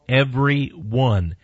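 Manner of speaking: Every one, slow and weighted on every; slow emphatic repeat. Acted. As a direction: lean hard on Every, slowly. Repeats scripture words for emphasis.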